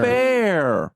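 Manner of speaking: mock child's complaint; complaining child. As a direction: imitate a protesting kid, stretching the word. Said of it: "the three words that kids use".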